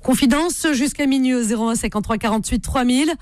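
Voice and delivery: normal talking voice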